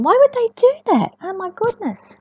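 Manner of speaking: ordinary delivery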